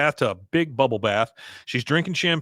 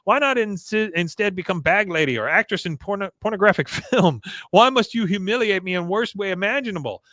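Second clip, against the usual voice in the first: whiny grown son; 7 semitones higher than usual. Retold or imitated. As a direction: whine at Mama like a wounded son. Son whining at his mother.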